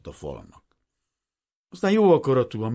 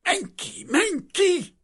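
very high-pitched wife's shriek; urgent cry; 4 dB louder, much slower than usual. A narrator's reading. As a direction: cry out to send him away, rising louder. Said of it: "oda kiáltott Vranára". (sürgetően, nagyon magas hangon kiáltva)

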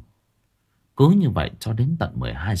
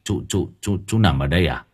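dazed uncle Tráng; 5 semitones lower, faster than usual. Acted. Uncle asks his small nephew, dazed and stammering. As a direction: stammer it out, barely awake after the nightmare.

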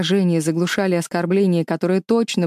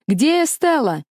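high, loud call through a downpour; shouting over rain; 8 semitones higher, 3 dB louder than usual. Acted. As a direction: yell it over the storm. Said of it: "прокричал он сквозь шум дождя".

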